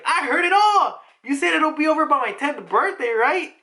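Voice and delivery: put-on high, excited little girl; bubbly high-pitched mock kid; gleeful child. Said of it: "And she's smiling".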